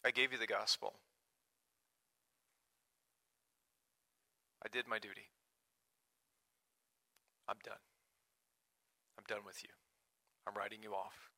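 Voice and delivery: a tired pastor quietly writing people off; weary giving up. Retold or imitated. Voicing burned-out pastor giving up.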